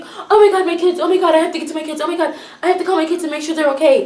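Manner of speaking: high, fast panicked babble; mock freak-out. Imitating a woman worried about her kids, freaking out. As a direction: imitate a frantic mother, words tumbling out. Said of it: "this woman starts freaking out".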